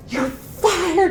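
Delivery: high-pitched mock firing; retold high-pitched outburst; imitated outburst. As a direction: blurt it out way up high, imitating an indignant kid. Acts out angry kid firing her.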